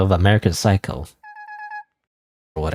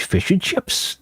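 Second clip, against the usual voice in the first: higher-pitched mock Australian; attempted Australian accent; 8 semitones higher, 4 dB quieter, much slower than usual. Gamer thinking of fish and chips, badly faked Australian accent. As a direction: put on a bad Australian accent, lifting at the end. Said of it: "I can't do Australian accent".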